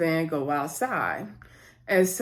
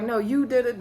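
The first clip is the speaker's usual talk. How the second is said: ordinary talk, nothing marked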